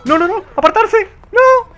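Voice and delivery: high, loud frantic shouts; panicked yelling. Real, not acted. Gamer screaming as his own grenade lands. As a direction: scream at them to get clear.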